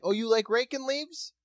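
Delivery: high, loud taunting voice; mocking the neighbor. Neighbor taunting the leaf-raker, high and mocking. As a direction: sneer at him like a petty villain. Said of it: "You're like".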